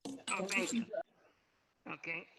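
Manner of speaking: ordinary talk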